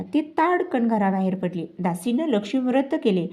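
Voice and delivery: ordinary delivery, nothing marked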